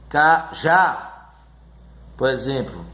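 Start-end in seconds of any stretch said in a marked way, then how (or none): 0.1-1.3 "cajá" split, ca... JÁ; two separate beats in "cajá"; syllable by syllable. Shown as a demonstration. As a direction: pause before the stressed last syllable of "cajá". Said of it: "a última sílaba é a mais forte".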